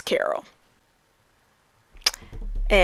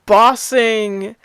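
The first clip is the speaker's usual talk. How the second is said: ordinary delivery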